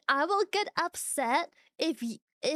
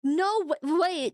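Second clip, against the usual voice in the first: ordinary delivery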